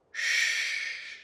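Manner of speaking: slow, drawn-out hush; one long predatory shush; single long shush. Acted. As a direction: hush your prey soothingly. Hushing frightened prey.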